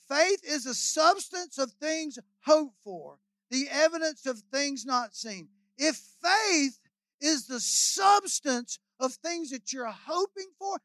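slow, weighty scripture delivery; slow emphatic preaching. Real, not acted. Preacher restates the faith verse to the congregation, slow and emphatic. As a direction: draw out the key words for the congregation.

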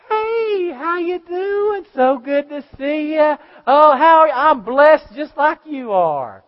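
high, loud, sugary churchgoer welcome, slowed right down; fake church greeting. Preacher mocking a fake-friendly churchgoer at the door, loud and high. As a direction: gush over people at the church door, sweetly fake. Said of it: "put on their faith face".

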